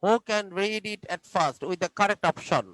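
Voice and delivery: very slow, drawn-out question; slow classroom question. Shown as a demonstration. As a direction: stretch each word for young pupils. Teacher asking class slowly.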